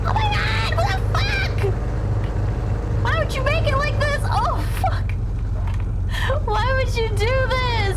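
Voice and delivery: high whining distressed yells; panicked yelling. Real, not acted. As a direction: yell in frustrated disbelief. Car wrecked, frustrated outburst.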